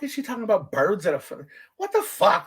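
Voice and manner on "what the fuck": baffled. Retold or imitated. Confused by mom's saying.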